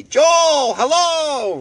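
high, loud drawn-out holler for Joel. Retold or imitated. Imitating a patient hollering for Joel, high loud holler. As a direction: call the name across the ward at full voice. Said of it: "we still heard this lady".

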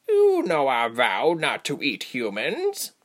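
slow, drawn-out creature growl. A narrator's reading. Female creature rebuking the pack, growling. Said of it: "she growled".

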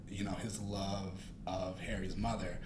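ordinary talk, nothing marked

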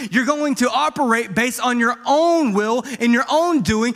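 high, driving pulpit exhortation; loud, urgent sermon climax; impassioned preaching. Real, not acted. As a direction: preach it with full force, stressing key words. Preaches the point at full force.

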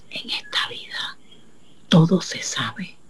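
hushed aside to the audience; whisper. A narrator's reading. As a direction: lean in and whisper the secret. Narrador confía un secreto al público. (susurro confidencial)

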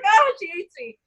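high, whiny, crying-edged voice; mock despair. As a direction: wail it like it's the end of the world. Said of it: "I was like".

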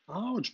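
high, loud "ouch"; complaining yelp. A narrator's reading. Wendy's hair is yanked by the fairy, complaining yelp. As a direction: yelp in sudden pain. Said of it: "beklagte sich Wendy".

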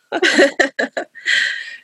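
airy helpless laugh; breathy laugh. Real, not acted. Host laughing at her own frustrated voice, airy laugh.

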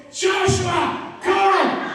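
high, loud sideline shouts; yelling coach dad. Retold or imitated. Dad retelling how he yelled at his son's soccer game. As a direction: cheer your son on like a coach. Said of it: "I, I was loud".

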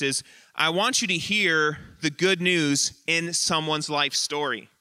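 ordinary talk, nothing marked

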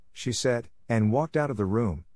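ordinary delivery, nothing marked

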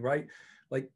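ordinary talk

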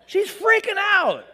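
high, loud laughing shout; laughing exclaim. Real, not acted. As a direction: burst out with it. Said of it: "laughing exclaim".

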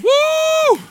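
exultant "woo", very high; high loud whoop. Real, not acted. Preacher worked up before the congregation, whooping.

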